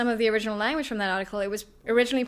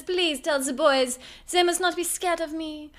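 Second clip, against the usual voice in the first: put-on slow, higher-pitched French accent; 6 semitones higher, slower than usual. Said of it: "she speaks with the French accent".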